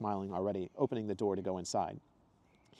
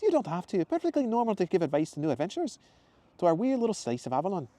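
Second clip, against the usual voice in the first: much higher, louder cheerful woman's voice; Zoe's exclamation; 10 semitones higher, 5 dB louder than usual. Acted. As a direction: greet him brightly and high. Zoe voiced.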